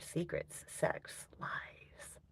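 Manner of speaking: breathy, hushed and theatrical; dramatic whisper. Pretend. Streamer teasing the chat about her party childhood, in a dramatic whisper. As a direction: hiss the words like a dark secret.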